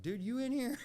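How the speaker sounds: high, loud searching call. Retold or imitated. Man calling for his buddy in the hotel suite, calling out. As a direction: call into the next room for a friend.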